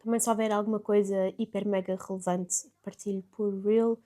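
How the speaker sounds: soft, low late-night murmur; hushed hotel-room voice, keeping it down; falar baixinho. Real, not acted. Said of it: "estou a falar mais baixinho". (voz baixinha de fim de noite)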